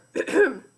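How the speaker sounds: loud throat clearing. Acted. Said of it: "cleared his throat".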